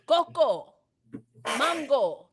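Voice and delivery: "Coco, mango" word by word, with "mahn-go"; Caribbean Spanish pronunciation. Shown as a demonstration. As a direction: demonstrate "Coco", pause, "mango" with open ah. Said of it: "not mango".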